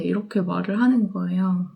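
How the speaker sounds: ordinary talk, nothing marked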